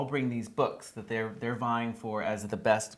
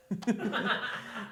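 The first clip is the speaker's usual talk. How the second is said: high laughter; 10 semitones higher, 5 dB quieter than usual. Real, not acted. Blooper after flubbed line.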